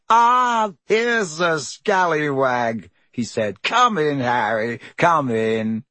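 beaming Lockhart. Acted. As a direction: greet him like a preening celebrity. Lockhart's showy welcome.

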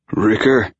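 agitated call for a teammate. A narrator's reading. Injured player calling for his teammate from behind the desk, agitated. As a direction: call out the name with mild agitation. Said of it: "sounding agitated".

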